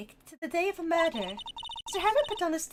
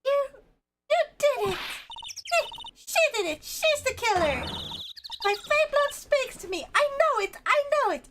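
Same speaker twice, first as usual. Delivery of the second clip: excited accusation; 9 semitones higher than usual. Acted. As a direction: point and shout it. Maya accusing the killer.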